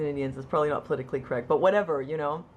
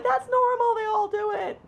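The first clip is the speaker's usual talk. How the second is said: very high, loud mock reassurance; hammed-up high-pitched friends; mimicking dismissive friends; 5 dB louder than usual. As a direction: sing out the brush-off way up high.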